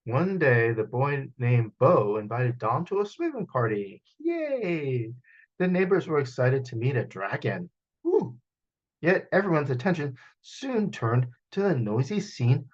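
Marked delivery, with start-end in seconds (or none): 4.2-5.1 higher, cheerful little cheer; happy yay. Acted. Dom cheers at the party invitation, higher. 8.0-8.4 very high squeal of woo; excited woo. Acted. Neighbors cheer at meeting a dragon, very high.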